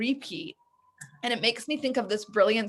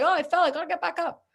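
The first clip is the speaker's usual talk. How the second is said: put-on higher, quick childlike voice; baby's inner voice; 7 semitones higher than usual. A toddler shrugging off a fall, higher and childlike. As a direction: chirp it like a toddler who just tumbled.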